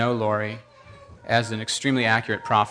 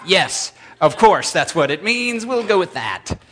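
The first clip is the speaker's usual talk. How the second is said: higher, slow, overly keen yes; mock eager agreement; 6 semitones higher, 3 dB louder, slower than usual. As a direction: grab the excuse with exaggerated relief. Sarcastic eager agreement to Lori.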